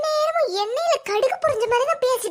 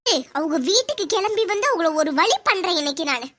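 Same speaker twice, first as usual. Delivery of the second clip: much lower, grim vow; determined threat; 8 semitones lower than usual. Acted. Sister vows to go over and set them straight, low and grim. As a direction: growl out a threat to settle the score. Vowing revenge on the mother.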